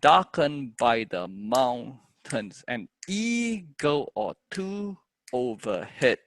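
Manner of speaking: slow, steady metered recital; poem in four. Shown as a demonstration. Teacher trying the poem in four, slow measured recital. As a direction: beat out the verse in four, holding stressed syllables. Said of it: "try this in four".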